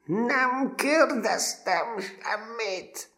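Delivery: extremely high, drawn-out furious shout; furious sergeant bellow; roaring bellow. A narrator's reading. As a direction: roar it in terrible fury. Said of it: "Most elbődült".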